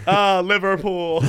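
loud, slow mock British; imitated British cheer. Streamer echoing friend's British guy, drawn-out imitation. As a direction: copy a British football fan.

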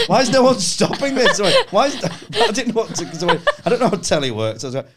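high, loud, half-laughing panic; panicked plea. Retold or imitated. Comic on stage, pleading loudly for someone to stop. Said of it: "And I was going".

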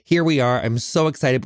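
normal talking voice

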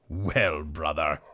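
put-on low, slow old donkey; gruff low donkey voice. Donkey speaks in the story.

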